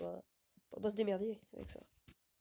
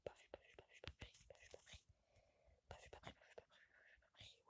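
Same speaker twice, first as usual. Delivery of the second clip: whisper; 24 dB quieter than usual. Pretend. Sneaking past enemies in game.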